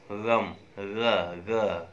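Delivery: ग़म with the nukta, a throaty fricative ग़ drawn out slowly; nukta pronunciation. Shown as a demonstration. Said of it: "इसका pronunciation क्या होगा". ("ग" को नुक्ते वाले ग़ की तरह, गले से धीरे बोलें)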